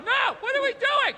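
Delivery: high-pitched, faraway voice of conscience; tiny high falsetto nagging voice; in-shape inner voice. Acted. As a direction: squeak out a protest from the back of the mind. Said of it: "my in-shape voice is in the back going".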